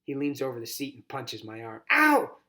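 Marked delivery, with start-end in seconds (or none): 1.9-2.4 sharp high yelp of pain; sudden pain cry. Acted. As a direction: yelp as the punch lands. Punched in the arm.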